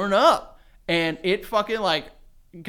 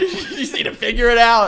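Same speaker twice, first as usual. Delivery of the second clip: laughing through it; 6 semitones higher, 8 dB louder than usual. Retold or imitated. Mother retelling her advice while laughing. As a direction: quote dissolving into laughter. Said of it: "I was like".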